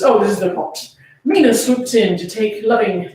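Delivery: ordinary delivery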